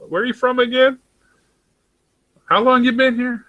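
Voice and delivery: high, bright questioning voice of a curious local; mock Kiwi local. Imitating New Zealanders asking where he's from, high and light. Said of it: "They're always like".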